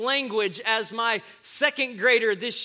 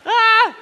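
loud laughing shout; 12 semitones higher, 12 dB louder than usual. Real, not acted. Big laugh at own punchline.

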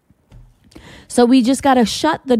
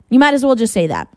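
normal talking voice

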